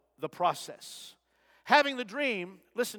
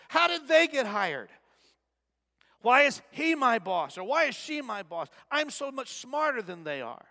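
higher, louder indignant griping; mock complaining; 5 semitones higher, 5 dB louder than usual. Preacher voicing a worker resenting his boss, indignant grumbling. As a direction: gripe about your boss, full of yourself. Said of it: "Or, or it might sound like this".

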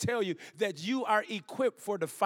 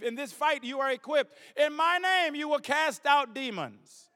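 fervent preaching; 6 semitones higher than usual. Acted. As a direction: proclaim the verses like a revival preacher. Preacher quoting scripture, rising.

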